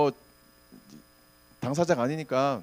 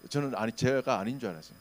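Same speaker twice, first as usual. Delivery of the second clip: normal talking voice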